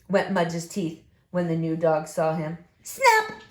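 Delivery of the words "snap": usual speaking voice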